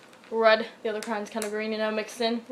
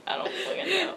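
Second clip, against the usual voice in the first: usual speaking voice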